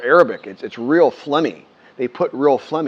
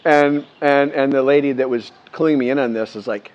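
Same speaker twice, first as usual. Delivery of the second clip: normal talking voice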